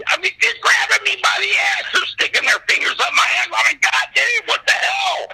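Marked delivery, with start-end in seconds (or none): 0.1-3.5 high-pitched, frantic mock outrage; hammed-up squeal of indignation; outraged complaint. Retold outraged complaint at being grabbed. 3.8-5.3 very high, yelped cursing; reenacted high-pitched outcry; outraged outburst. Retold outburst at being grabbed.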